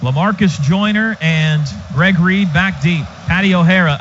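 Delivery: usual speaking voice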